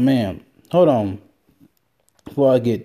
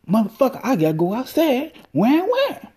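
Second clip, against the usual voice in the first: dog's voice; 12 semitones higher than usual. Pretend. Podcaster voices his dog begging to go outside at night, high and whiny. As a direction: whine like an impatient dog begging. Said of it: "And she go".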